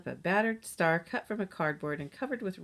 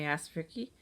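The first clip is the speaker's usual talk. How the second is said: ordinary delivery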